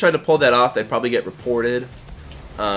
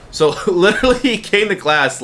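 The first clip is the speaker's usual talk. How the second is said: laughing; 6 semitones higher, 4 dB louder than usual. Real, not acted. Laughing while retelling.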